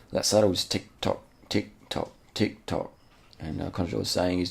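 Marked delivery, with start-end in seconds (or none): 0.6-2.9 evenly spaced beats, like a metronome; rhythmic ticking. Shown as a demonstration. Commentator mimics the saddle's sway, rhythmic ticking. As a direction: tick it out in time with the swaying.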